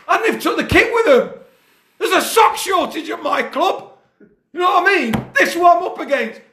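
high, heated rant with laughs; ranting high and wound up, half laughing; exasperated coach ranting about scouts; raging rant. Real, not acted. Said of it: "I'm fucking raging".

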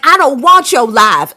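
teen whining about his life, mock Southern accent; Southern accent quote. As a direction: play the whiny son in a put-on Southern accent. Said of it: "in a Southern accent".

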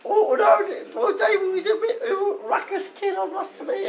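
high, put-on comic mum voice; Anne. Mother phoning the show about Dave, high falsetto. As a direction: play the caller's mum in falsetto. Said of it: "It's Dave's mum".

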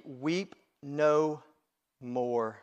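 slow, gentle, falling reassurance; gracious comfort. A narrator's reading. Elder consoling weeping John, slow and gracious. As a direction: let each word settle, softening to the end. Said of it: "Do you hear those gracious words?".

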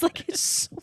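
ordinary talk

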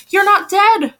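high, loud cry of relief; emotion-filled cry. A narrator's reading. Mother seeing Mimi alive, emotion-filled cry. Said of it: "Mother cried in an emotion-filled voice".

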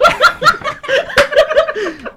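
loud, high laughing fit; high burst of laughter. Real, not acted. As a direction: break into a shrieking laugh. Bursts out laughing mid-sentence.

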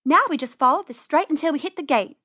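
plucky rabbit voice; confident sister. Acted. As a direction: urge a little brother onward. Rabbit sister explains the plan.

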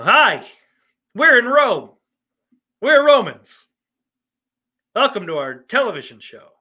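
loud, high, overacted TV Roman greeting; booming mock-Roman welcome; hammy Roman actor. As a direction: announce yourselves as Romans, over the top. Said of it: "Do they just talk like they're fucking".

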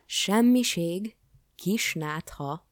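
loud, high, drawn-out outraged questions; indignant echo. A narrator's reading. King to the dismissive jester, indignant echo. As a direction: throw each word back in slow disbelief. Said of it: "háborgott a király".

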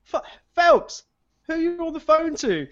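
put-on spotting the drunk friend on the bench, high call. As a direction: call to him across the grass. Calling to friend outside.

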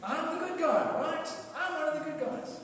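high, loud, acted self-congratulation; mock-smug, loud and high; self-righteous boasting. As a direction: proclaim your own goodness, pitched right up. Acting out a self-satisfied churchgoer.

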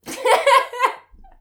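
a big high burst of laughter; loud laugh. Real, not acted. Laughing with her co-host at the joke, high and loud. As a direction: crack up out loud.

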